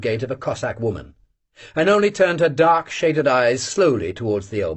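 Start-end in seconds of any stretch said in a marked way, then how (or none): none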